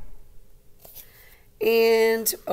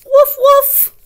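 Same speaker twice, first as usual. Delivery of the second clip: high, loud yappy puppy woofs; puppy bark; 16 dB louder than usual. Acted. Teacher reading Biscuit, barking as the puppy. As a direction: bark like a little puppy for the kids.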